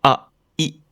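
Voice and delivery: "a, i" cut off short; short vowels. Shown as a demonstration. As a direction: clip each vowel abruptly. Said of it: "ne peut pas être prolongé".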